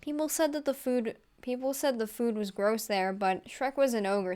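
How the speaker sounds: usual speaking voice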